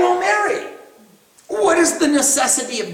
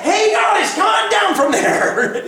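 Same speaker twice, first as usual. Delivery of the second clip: calling out; 9 semitones higher than usual. Retold or imitated. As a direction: shout up to the kids to come down. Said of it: "So we said".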